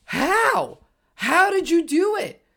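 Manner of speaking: amazed disbelief. Real, not acted. Host marvelling at Troy Weaver's trade, amazed. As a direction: blown away by the trade.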